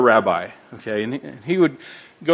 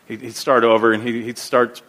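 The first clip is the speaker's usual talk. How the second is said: normal talking voice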